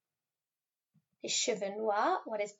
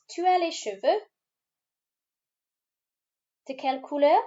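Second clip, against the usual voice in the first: Tu as les cheveux de quelle couleur with a big rising tune, higher and louder; exaggerated question tune; 5 semitones higher, 7 dB louder than usual. Shown as a demonstration. Teacher drilling a French question for pupils, bold rising tune. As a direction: sing the question up high, clearly rising on couleur. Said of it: "Let's practice that question".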